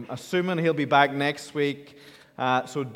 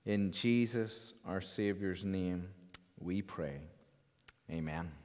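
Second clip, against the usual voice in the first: prayer; 6 semitones lower, 3 dB quieter than usual. Acted. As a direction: pray reverently, quieter toward the amen. Leads the congregation in prayer.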